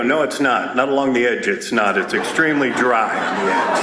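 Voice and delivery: very low, dry contradiction; contrarian heckler. Acted. Someone behind the speaker disputing the ocean is wet, low contrarian. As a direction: drop deep and disagree from behind.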